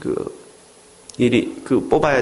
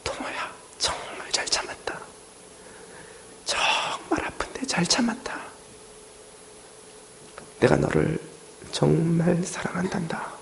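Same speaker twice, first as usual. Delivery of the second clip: soft, hushed words of comfort; whispered Jesus voice; 3 semitones higher, 10 dB quieter than usual. Retold or imitated. Jesus comforts the brave boy, in a tender whisper.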